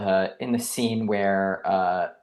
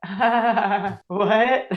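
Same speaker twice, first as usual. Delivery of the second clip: confused laugh, pitched up high; baffled laughing; 10 semitones higher than usual. Retold or imitated. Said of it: "the audience was like".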